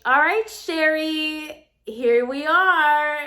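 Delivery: high, drawn-out mock-cheery reveal; putting on cheer. Stylist revealing disastrous hair to client.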